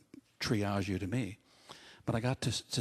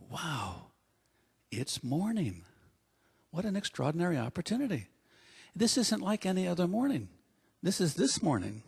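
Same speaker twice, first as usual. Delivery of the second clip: builds from a hushed wow to a higher, excited voice; mounting wonder; 6 semitones higher than usual. Shown as a demonstration. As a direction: greet the morning like an eager dog. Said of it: "be a little bit more like Murphy".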